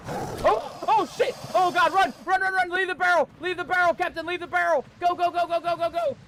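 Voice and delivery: high, loud panicked shouts; panicked yelling. Real, not acted. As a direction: scream at them to run. Fleeing jaguar in panic.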